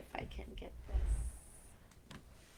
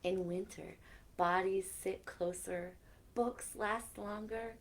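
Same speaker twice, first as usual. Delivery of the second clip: gentle storybook reading with soft whispered lines; reading to children; 5 dB quieter than usual. Acted. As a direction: read it like a bedtime story to kids. Reading a picture book aloud.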